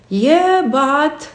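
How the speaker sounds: a sceptical inner voice objecting to a great idea; doubtful yeah but. Acted. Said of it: "We call that voice Mister or Missus Yeah, but".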